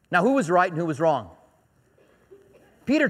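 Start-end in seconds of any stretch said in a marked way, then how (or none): none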